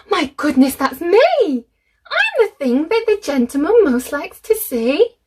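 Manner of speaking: bursting with happy pride; delighted. A narrator's reading. Edward realises the visitor means him, thrilled.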